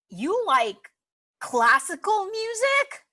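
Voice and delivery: drawn out, climbing high at the end; slow, rising disbelief; incredulous question. Acted. Critic questioning her taste in music, incredulous.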